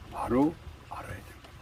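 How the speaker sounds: hushed, breathy whisper; whispered emphasis. Acted. Preacher whispering a key truth to the congregation, hushed. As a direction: lean in and whisper the point.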